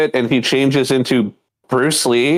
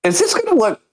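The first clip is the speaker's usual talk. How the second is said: incredulous; 7 semitones higher than usual. Retold or imitated. Mock outrage at the old episode.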